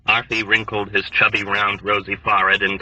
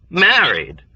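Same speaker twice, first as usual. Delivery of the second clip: mocking disbelief; 6 semitones higher, 4 dB louder than usual. A narrator's reading. Incredulous mocking echo.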